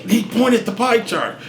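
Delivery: normal talking voice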